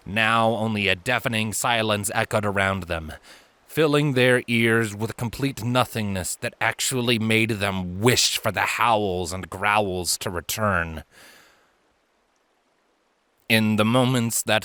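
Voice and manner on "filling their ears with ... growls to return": ordinary delivery, nothing marked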